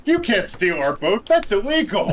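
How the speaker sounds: high, whiny cartoon complaint; squeaky indignant protest; mock pirate protest. Characters quoting the show.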